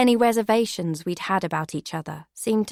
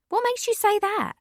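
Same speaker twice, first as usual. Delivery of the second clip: much higher questioning voice; puzzled Becky; 14 semitones higher than usual. Acted. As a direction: press her for a reason. Becky sceptical reply.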